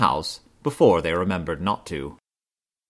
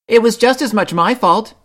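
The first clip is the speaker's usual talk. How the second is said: high, loud cub shout; shouted confession; 12 semitones higher, 8 dB louder than usual. A narrator's reading. Brother Bear confessing to Mama, shouting. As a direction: shout it out to own the blame. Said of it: "shouted sister".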